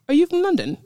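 lightly imitated English accent. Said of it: "he's speaking in an English accent".

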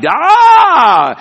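a high, loud cry of protest; grandma cutting the boy off with one sharp, scandalized yell; grandmother's outraged shout. Retold or imitated. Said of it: "And she would be like".

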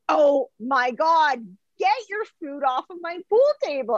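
high, hammed-up exasperation; mock pissed off. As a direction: imitate an outraged wife ordering food off the table. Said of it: "she was really pissed off".

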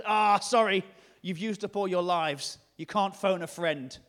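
loud, put-on apologetic refusal; mock regret. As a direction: turn them down like a game show host. Imagined Jesus refusing someone.

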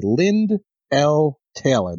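slow, deliberate "Lynd L Taylor"; very specific. Retold or imitated. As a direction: space out "Lynd L Taylor" word by word. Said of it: "It says very specifically".

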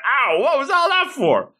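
high mock yelp of complaint; indignant, high-pitched acted outcry; pained protest. Acted boy, bag yanked from him.

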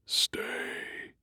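breathless whispered command; eerie whisper. Acted. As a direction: whisper it like something unseen. Eerie voice inside his head.

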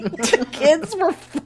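words squeezed out through laughing; too tickled to get voice out; helpless laughter. Real, not acted. Embarrassed hiker telling about kids, voiceless laughter.

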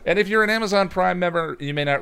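ad read. Acted. Ad-read hype voice.

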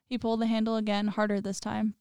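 measured, subdued horror-story reading; story narration. A narrator's reading. Narrator telling listeners a basement horror story, measured narration. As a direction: read it like a grim audio tale.

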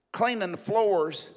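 ordinary talk, nothing marked

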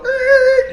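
sharp high-pitched squeal; a loud screech of brakes; high squealing brake noise. Shown as a demonstration. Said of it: "So I'm gonna go".

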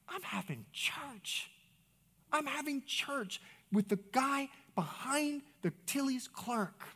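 high, slow, stretched-out wonder; drawn-out elation. Retold or imitated. Pastor having church with a store clerk, elated. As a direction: draw each word out high in delight. Elated aside about sharing the gospel.

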